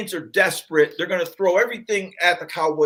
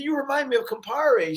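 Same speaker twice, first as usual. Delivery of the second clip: lightly voiced old Italian mother; old mom voice; 6 semitones higher than usual. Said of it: "they said".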